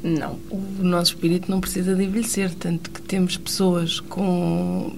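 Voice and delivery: ordinary talk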